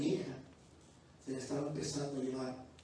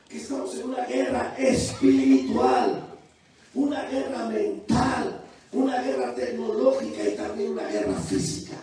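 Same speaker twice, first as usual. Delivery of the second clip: preaching at full force; 7 semitones higher, 13 dB louder than usual. Acted. Preaching climax to congregation.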